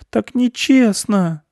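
high, drawn-out mouse wail; weeping little mouse; crying. A narrator's reading. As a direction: whine it tearfully and slowly. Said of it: "плакал мышь".

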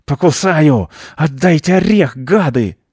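bare teeth and threaten; furious threat. Acted. Squirrel snarls threats at the children.

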